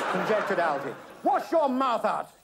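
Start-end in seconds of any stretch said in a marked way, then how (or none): 1.2-2.3 higher, drawn-out scolding command; stern order. A narrator's reading. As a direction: order him sharply to clean up his language. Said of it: "ordered Biggles".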